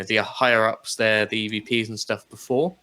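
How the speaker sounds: normal talking voice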